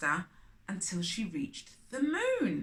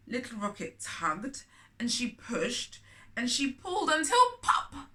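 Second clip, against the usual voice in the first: ordinary delivery, nothing marked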